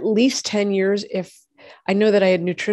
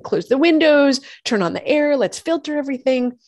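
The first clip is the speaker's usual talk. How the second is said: higher, louder mock yell of urgent orders; retold scream; 6 semitones higher, 3 dB louder than usual. Ordering the family as lead dust blows over, in a half-acted yell. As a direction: bark the orders at the family as if it's happening now. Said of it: "I'm, like, screaming".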